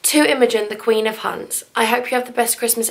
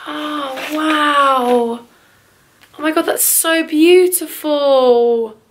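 awed; 3 semitones higher, 4 dB louder, much slower than usual. Real, not acted. Awed at card picture.

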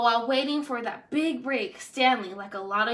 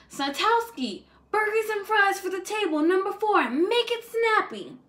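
higher, impatient order-barking voice; bossy restaurant manager; 9 semitones higher than usual. A narrator's reading. Manager ordering waiter Stanley around, higher and impatient. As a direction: snap the orders across the diner.

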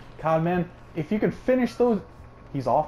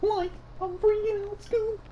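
very high, thin cartoon panic; hammed-up frightened squeak; mock-scared cartoon voice; 3 dB quieter than usual. Same cartoon panic bit.

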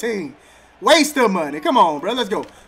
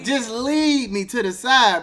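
slow exasperated; 3 dB quieter, slower than usual. Real, not acted.

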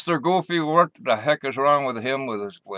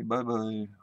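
a lightly voiced, lower, softer "Bye-bye."; Russian girl voice; 4 semitones lower, 9 dB quieter than usual. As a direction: mimic a Russian girl saying "Bye-bye." quietly. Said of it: "And the Russian girl say".

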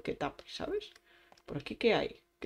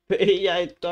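louder, with a laugh; 9 dB louder than usual. Real, not acted. Gamer laughing as he grabs loot, louder. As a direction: laugh through the words as you grab it.

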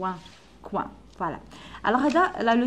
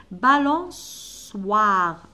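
Balançoire drawn out very slowly; very slow model word; 5 dB louder, much slower than usual. Shown as a demonstration. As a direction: stretch every syllable of Balançoire. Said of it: "نسحقوا wa". (Balançoire ببطء شديد)

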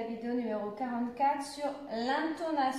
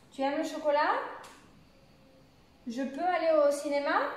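questions rising on CHOCOLAT and CINÉMA; interrogative intonation; 3 semitones higher, 3 dB louder, faster than usual. Shown as a demonstration. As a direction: let "chocolat" and "cinéma" climb right up at the end. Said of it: "ça monte à la fin".

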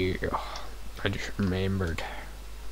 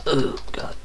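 whispering; 5 semitones higher than usual. Real, not acted. Whispering in suspense.

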